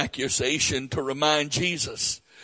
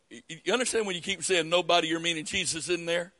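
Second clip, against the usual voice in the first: fast, softer aside; 5 dB quieter, much faster than usual. Real, not acted. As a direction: rush it under your breath. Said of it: "aside to the congregation".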